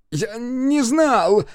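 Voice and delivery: high, drawn-out desperate cry; anguished cry. A narrator's reading. Sam protests to the detective about his dying sister, anguished cry. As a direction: cry out in anguish, stretching the words. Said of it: "крикнул он".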